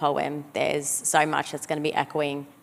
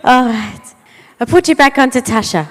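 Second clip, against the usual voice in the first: put-on loud, fast Maltese grandma accent; 7 semitones higher, 10 dB louder than usual. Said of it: "take off my other grandma in Maltese".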